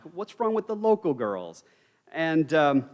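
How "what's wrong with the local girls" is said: lightly voiced, higher-pitched mother; a hint of a protesting mother. Mother questions her son's choice of wife, lightly voiced and higher.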